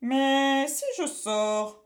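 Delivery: slow, loud stern witch; a witch warning the boy. A narrator's reading. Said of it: "reprit-elle sévèrement".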